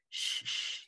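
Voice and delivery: soft whispered shushing; hushing. A narrator's reading. Shushing the screwed-up face of a crying baby, whispered hush. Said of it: "he said to the screwed up face".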